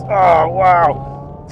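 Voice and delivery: loud, drawn-out real wonder; amazed. Real, not acted. Driver feeling full power on track, amazed. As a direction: let the thrill burst out, voice lifted.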